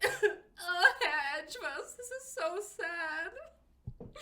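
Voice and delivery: high, laughing fake sob; mock crying. Friend reads a sad file, mock sobbing. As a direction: ham up a blubbering wail through laughter. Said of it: "and he's like".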